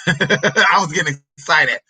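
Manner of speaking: laughing through the words; slow, amused admission broken by laughter. Real, not acted. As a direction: say it while cracking up. Laughs at own mistake.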